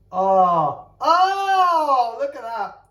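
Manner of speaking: loud, high cry at the first bite; real shock. Real, not acted. First bite of the spicy sandwich, a loud high cry. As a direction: gasp out loud at the flavour.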